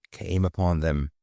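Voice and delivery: usual speaking voice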